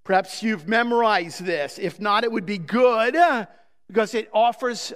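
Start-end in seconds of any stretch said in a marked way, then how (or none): none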